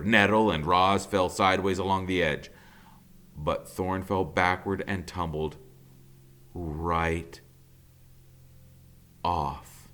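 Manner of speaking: ordinary delivery, nothing marked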